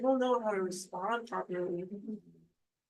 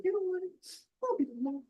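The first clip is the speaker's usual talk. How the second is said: playful high offer; 11 semitones higher, faster than usual. Pretend. Offering to be his mom.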